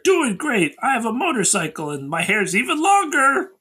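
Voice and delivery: high, upbeat mock boast; cheery postcard voice. Character writes home on a postcard, high and chirpy. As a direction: read it out high and pleased with yourself.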